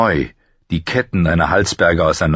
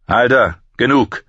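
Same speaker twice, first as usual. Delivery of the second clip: slightly raised, higher call; firm intervention; 5 semitones higher than usual. Acted. Jon tells Halder to stop, firmly calling out. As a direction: cut in to halt the fight. Jon calls a halt to beating.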